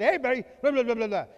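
loud, high drill-sergeant bark; gruff coach bark. Retold or imitated. Dad barking Saturday chores at his girls. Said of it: "just said".

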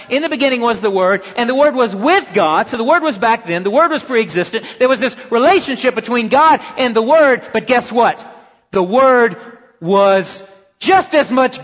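emphatic preacher building to the key claim; preaching at full force. Real, not acted. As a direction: drive the point home to the congregation.

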